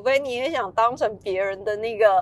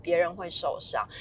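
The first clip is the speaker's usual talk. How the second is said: soft, low, steady voice; quiet, lowered, gently insistent; gentle but firm; 4 semitones lower, 8 dB quieter than usual. Shown as a demonstration. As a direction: tell a child calmly and firmly. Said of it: "用温柔且坚定的语气告诉他".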